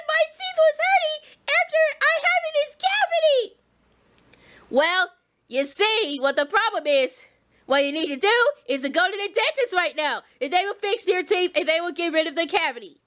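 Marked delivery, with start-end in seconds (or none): 4.7-13.0 deep motherly warning; Harriet voice. Pretend. Harriet voice again.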